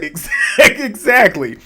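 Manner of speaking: high, slow laugh through the word; breaking into laughter mid-word. Real, not acted. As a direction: laugh out the agreement. Laughing agreement.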